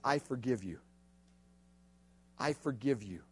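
soft, low and gentle; hushed tender forgiveness. Acted. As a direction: murmur it like a gift. Quoting sweetest words ever.

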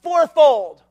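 slow emphatic shout; stern sentence. Retold or imitated. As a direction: hand down the punishment. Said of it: "He said".

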